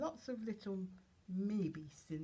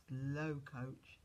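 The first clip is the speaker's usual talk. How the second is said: ordinary delivery, nothing marked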